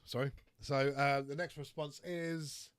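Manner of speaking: usual speaking voice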